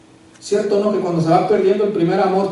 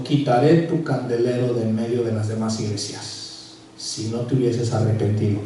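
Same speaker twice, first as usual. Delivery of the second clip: lower, softer, slow solemn warning; stern Jesus voice; 7 semitones lower, 5 dB quieter than usual. Acted. Jesus warning the church in Ephesus, low and grave. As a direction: speak it as a grave divine warning.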